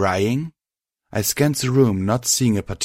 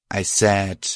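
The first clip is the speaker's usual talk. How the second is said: ordinary delivery, nothing marked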